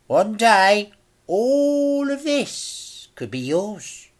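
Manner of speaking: a villain dangling a prize; Mister Big, tempting. A narrator's reading. Mister Big calls after boy.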